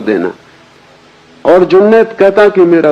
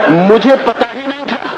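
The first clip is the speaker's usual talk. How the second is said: much higher voice, mock tearful gratitude; high-pitched, overwhelmed comic quote; Mulla Nasruddin, overcome; 12 semitones higher than usual. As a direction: play a man choked up by his farewell. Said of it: "Nasruddin खड़ा हुआ गदगद, आंख से आंसू झर रहे हैं".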